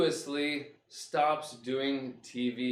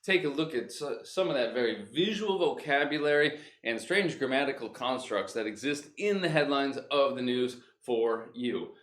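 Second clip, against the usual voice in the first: ordinary delivery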